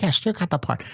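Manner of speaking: high imitated producer; Bob Wellborn. Producer confirming the casting to a surprised actor, high impression. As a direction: reassure him in the producer's put-on voice. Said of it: "He talks like this".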